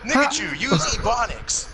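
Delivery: high, loud trainer calling an attack; mock Pokémon battle call. Friends riffing on Pokémon, hammed-up trainer shout. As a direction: bark it out like a cartoon trainer.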